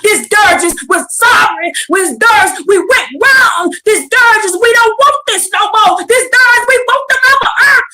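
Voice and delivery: rising preacher's cry of repentance; preaching at full force. Acted.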